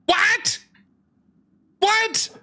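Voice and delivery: high, stunned double take; can't believe what he just heard; shocked. Real, not acted. Shocked someone accepts challenge.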